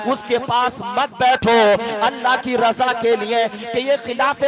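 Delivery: loud, ringing sermon delivery; forceful preaching. Acted. Preacher urging the congregation to choose godly company, forceful preaching. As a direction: preach it out to the whole gathering.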